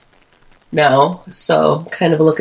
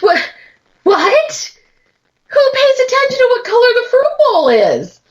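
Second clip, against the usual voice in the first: high, incredulous outrage; stunned disbelief. Real, not acted. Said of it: "disbelief at stupid trivia".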